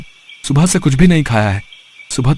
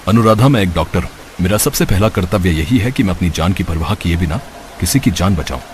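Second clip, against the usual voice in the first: a composed doctor deciding to help; calm doctor; 7 semitones lower than usual. Acted. Doctor figure speaks. (शांत, गहरी doctor की आवाज़)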